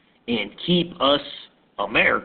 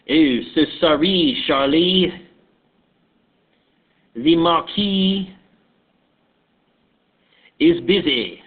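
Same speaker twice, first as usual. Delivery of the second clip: put-on French accent; 2 semitones higher than usual. French official greeting Charles Pinckney, put-on French accent. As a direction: play a French official with a thick-tongued French accent. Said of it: "I'm going to put on my French accent".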